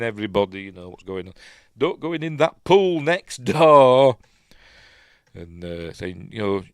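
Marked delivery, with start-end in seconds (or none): 1.8-4.2 loud, raised-pitch scolding; indignant warning. Acted. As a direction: call out a cross warning. Acting out Bernie's warning.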